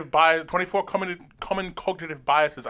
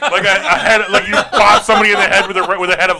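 loud, laughing through the words; cracking up while pitching the joke; 4 semitones higher, 12 dB louder than usual. Real, not acted. To the co-hosts, laughing loudly at his own lettuce joke.

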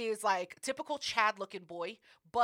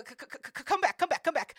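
stuttering; 6 semitones higher, 4 dB louder, faster than usual. Retold or imitated. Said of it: "not only does he stutter".

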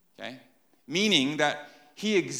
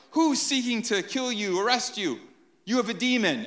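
higher, accusing crowd voice; mocking crowd; 8 semitones higher than usual. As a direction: jeer like the crowd. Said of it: "The crowd's like".

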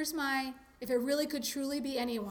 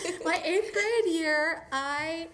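slow excited; 6 semitones higher, 6 dB louder, much slower than usual. Real, not acted. Restarted aloud and slow.

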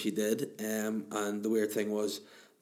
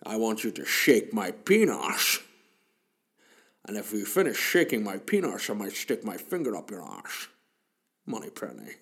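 Sean Connery impression. Bond talks to Miss Moneypenny in a Scottish accent. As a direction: ham up a Scottish Bond with every s as sh. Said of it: "Sean Connery, anything with an S".

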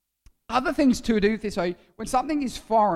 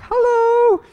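very high, loud squeaky little greeting; booger popping out saying hello; 12 dB louder than usual. Acted. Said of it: "it's like".

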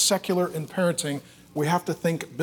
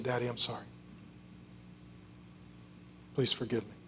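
very soft, low apology; barely voiced, tender plea for forgiveness; hushed apology; 5 semitones lower, 16 dB quieter, faster than usual. Child saying sorry to daddy, hushed and contrite.

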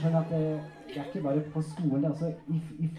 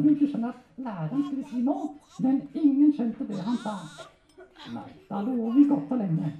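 high, eager ventriloquist puppet voice; puppet storyteller; 9 semitones higher, 6 dB louder than usual. Acted. Puppet telling handler a school story, high comic voice. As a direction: tell it higher and louder, as a comic puppet.

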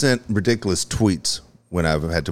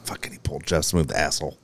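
muttering; 7 semitones lower, 8 dB quieter, faster than usual. Retold or imitated. Retold grumble at friend.

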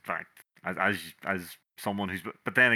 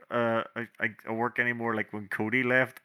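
ordinary talk, nothing marked

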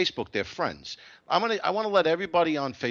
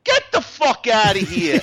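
louder, slow, laughing dismissal; loud laughing rant; 5 semitones higher, 9 dB louder, slower than usual. Real, not acted. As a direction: scoff loudly through a laugh. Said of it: "ranting at Facebook".

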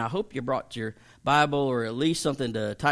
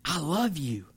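tender pastor voice; much slower than usual. Retold or imitated. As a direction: let a pastor's heart pour out gently. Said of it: "he was like".

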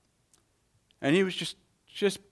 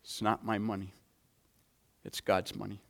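much softer and lower, "not" leaning gently; quiet conviction; 7 semitones lower, 12 dB quieter than usual. Real, not acted. Pastor answering the gambler's boast, quiet and sincere. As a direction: let "It's not my money" drop to a hush.

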